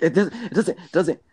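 mocking friend's denial. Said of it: "He's like".

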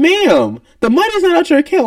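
high, fast, disbelieving explanation; exasperated. Retold or imitated. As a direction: say it like you're losing patience. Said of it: "I'm just sitting here like".

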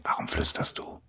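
whispered question; whispering. Real, not acted. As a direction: whisper back. Whispers back in reply.

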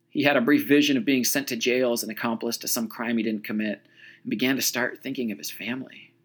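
usual speaking voice

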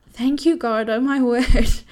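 overwhelmed crying thanks. Retold or imitated. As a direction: cry out thanks, overwhelmed with joy. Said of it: "I started crying".

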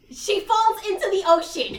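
loud, high, incredulous retelling; worked up, loud storytelling; excited exclamation. Real, not acted. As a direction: shout out the big plot twist. Climax of the retold plot.

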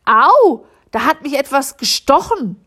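loud, high yelp of pain; mother cries out when something stings her, loud yelp; crying out in pain. A narrator's reading. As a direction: cry out as if stung. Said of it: "rief Frau Müller".

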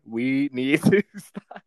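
slow line broken by a laugh; laughing through it. Real, not acted. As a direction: try to say it straight but crack up.

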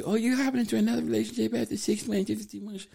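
high, hurried mocking voice; mock-scandalized onlooker, quick and high; judgmental onlookers. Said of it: "people always look at you crazy".

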